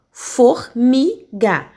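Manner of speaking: formiga drawn out very slowly; teacher giving a three-syllable example; slow example word. Shown as a demonstration. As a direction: stretch out formiga so each syllable is heard.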